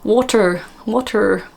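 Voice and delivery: West Country "Water" with a strong final R. Shown as a demonstration. Host piecing the accent together, West Country. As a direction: sound the R like a West Country speaker. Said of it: "West Country person would be".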